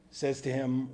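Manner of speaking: usual speaking voice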